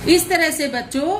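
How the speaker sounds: ordinary delivery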